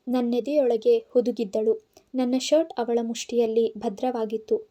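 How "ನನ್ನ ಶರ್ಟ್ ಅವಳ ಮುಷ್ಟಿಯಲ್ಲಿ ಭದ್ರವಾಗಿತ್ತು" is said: ordinary delivery, nothing marked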